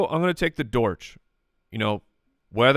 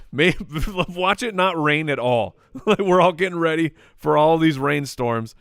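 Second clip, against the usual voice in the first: higher-pitched, laughing through the words; 4 semitones higher, 4 dB louder than usual. Real, not acted. Joking to co-host about rainstorms that may never come, laughing. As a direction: chuckle as you talk. Laughing over rain forecasts.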